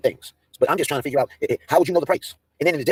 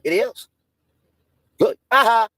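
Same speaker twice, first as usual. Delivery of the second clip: ordinary talk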